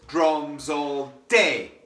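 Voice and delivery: "drums all day" chanted with "day" stressed; accent on day. Shown as a demonstration. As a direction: lean into "day". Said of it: "day is that accented note".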